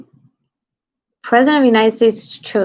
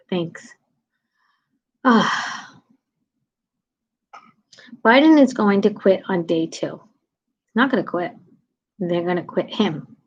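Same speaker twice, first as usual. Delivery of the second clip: ordinary delivery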